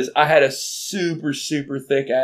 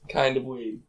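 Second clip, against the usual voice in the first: usual speaking voice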